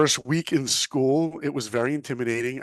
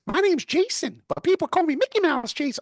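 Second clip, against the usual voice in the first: much higher pitched goofy nerd voice; squeaky roommate voice. Retold or imitated. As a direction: chirp it out like a proud cartoon fan. Roommate voiced in retold story.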